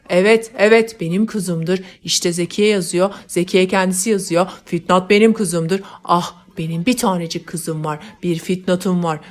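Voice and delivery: loud, manic rebuke of the weeping maids; the grief-mad father scolds the maids and rejoices; scolding, crazed with joy. Acted. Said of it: "halayıklara çıkışarak".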